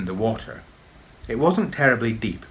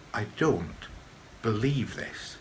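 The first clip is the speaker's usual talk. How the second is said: soft, stunned croak; 7 dB quieter, slower than usual. A narrator's reading. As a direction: barely get it out in disbelief. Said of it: "croaked Tam's dad".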